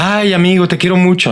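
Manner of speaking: high, mock gushing "te quiero mucho"; gushing friend, softened. Friend softening affection, lightly hammed-up gushing. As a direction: coo it like a sappy friend. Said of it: "por qué decimos".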